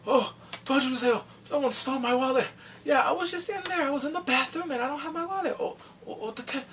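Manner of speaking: high, frantic fake panic about a stolen wallet; mock panicked dad. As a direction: squeak it out like a flustered father faking a theft. Father fakes a stolen wallet diversion.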